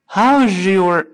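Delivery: "How's your" fused as how-zhur; high, stretched "How's your" with the s turned to zh; linked sound change. Shown as a demonstration. Teacher shows a common sound change, "How's your" said how-zhur. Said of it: "这是一个常见的音变".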